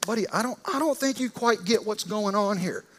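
loud, high, boasting "I'm in charge"; puffed-up authority. Retold or imitated. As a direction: swagger and bully. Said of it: "He's trying to get Jesus' attention".

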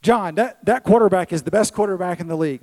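a little boy bragging, lightly voiced; boastful kid.

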